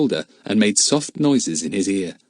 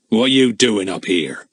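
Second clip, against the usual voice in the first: gruff villain voice. Acted. Gruff man grabs boy at night.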